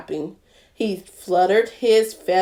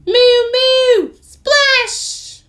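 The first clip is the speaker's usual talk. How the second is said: a silly high cow cry into the water; high loud mooing splash; 7 dB louder, much slower than usual. Acted. Cow plunges past the duck, high and loud.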